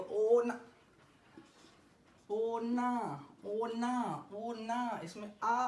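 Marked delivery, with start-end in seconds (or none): none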